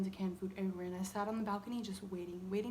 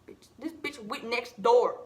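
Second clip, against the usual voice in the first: louder, slower indignant outburst; outraged; 8 dB louder, slower than usual. Real, not acted. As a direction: spell out the outrage slowly. Outraged about the cat.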